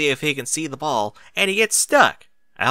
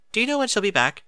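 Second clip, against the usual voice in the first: higher, lilting ladylike question; Rarity voice; 11 semitones higher, faster than usual. Acted. Rarity asking Spike when Twilight returns, higher ladylike. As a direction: ask brightly in a refined lady's voice.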